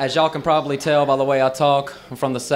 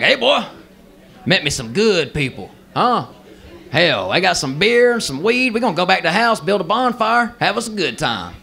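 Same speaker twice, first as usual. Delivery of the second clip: Uncle Worm; 4 semitones higher than usual. Acted. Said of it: "He turns into this redneck gremlin".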